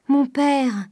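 high, urgent cry of a pleading daughter; cried out. A narrator's reading. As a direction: cry out to your father, pitched well up. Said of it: "cria Eugénie en se jetant à genoux". (cri suppliant, voix haut perchée)

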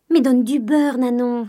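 put-on insistent young woman calling out; urgent exclamation. A narrator's reading. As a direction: cry it out as an eager order. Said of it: "s'écria Eugénie".

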